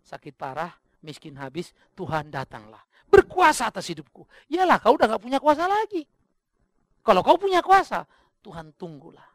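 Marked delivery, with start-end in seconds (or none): 3.1-8.0 high, loud rebuke from the pulpit; full-force preaching. Acted. Preacher mocking those who surrender only when helpless, loud high rebuke. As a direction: drive it home loudly, pitch raised.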